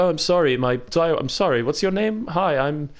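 light, polite Canadian-sounding apology; hint of a Canadian accent, sweetly polite. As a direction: play an overly apologetic Canadian. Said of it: "your Jon Snow accent just sounds like a Canadian".

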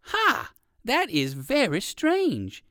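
high surprised ha, then slow; surprised exclaim. Acted. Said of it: "exclaimed the rabbit".

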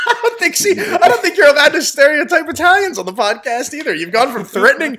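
laughing tease. Real, not acted. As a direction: tease him while cracking up. Teasing friend while laughing.

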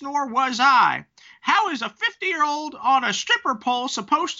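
put-on very high-pitched, indignant old lady voice. Podcast host reading angry Instagram comments aloud, high old woman voice. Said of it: "gonna try and do the voices".

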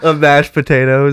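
high, loud giggly voice; laughing goofy voice. Pretend. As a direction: say it while laughing.

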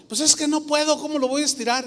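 high-pitched protest of a simple man; simple old grandfather. Retold or imitated. Simple grandfather answers the preacher, imitated high-pitched protest. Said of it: "mi abuelo era un hombre bien sencillo".

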